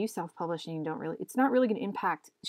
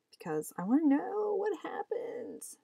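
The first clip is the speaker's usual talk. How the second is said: whispered plea; 9 semitones higher, slower than usual. Real, not acted. Begging her partner to show the comic ending, whispered. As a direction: hush it like a secret wish.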